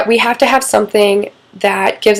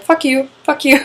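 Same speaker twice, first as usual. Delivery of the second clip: joking fuck you; 5 semitones higher than usual. Retold or imitated. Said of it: "you're like".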